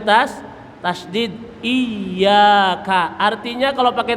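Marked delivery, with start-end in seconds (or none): 1.6-3.1 Iyyaka with a held double ya; doubled ya. Shown as a demonstration. Teacher stresses the tasdid in Iyyaka. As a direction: lean on the ya in Iyyaka. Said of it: "iyanya tasdid".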